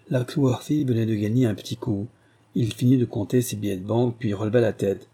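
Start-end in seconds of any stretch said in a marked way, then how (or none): none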